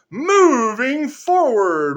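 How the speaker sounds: slow, loud and high; loud declaration. Real, not acted. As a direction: proclaim it slowly.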